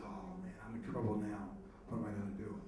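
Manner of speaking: a touch of sinking dread, voice dropped; mildly dismayed. Retold or imitated. Joke figure dismayed after failed joke.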